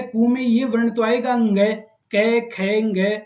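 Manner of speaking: usual speaking voice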